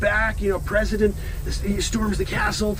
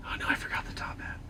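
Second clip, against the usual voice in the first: fast whisper of dismay; horrified, hushed; whispered realization; 13 dB quieter, faster than usual. Acted. Lincoln realizing the missing top hat, whispered.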